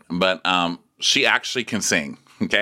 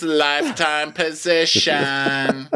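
slow high gloating boast; 9 semitones higher, slower than usual. Retold or imitated. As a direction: savor it, slow and smug. Gloating proclamation.

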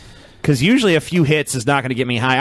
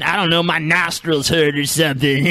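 stoner voice; 5 semitones higher than usual. Retold or imitated. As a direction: play a spaced-out stoner. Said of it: "that's T J's old stoner voice".

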